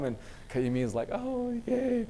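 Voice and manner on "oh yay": mock cheer. Kayumi reacts.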